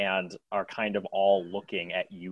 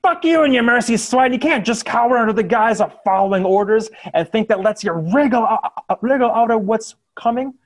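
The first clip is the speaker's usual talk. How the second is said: high, loud furious yelling; furious curse; 12 semitones higher, 13 dB louder, faster than usual. Acted. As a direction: hurl it at the prisoners. Civilian curses the prisoners.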